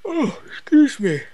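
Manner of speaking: talking mid-yawn. Real, not acted. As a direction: yawn through the words. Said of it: "Unexpected yawn".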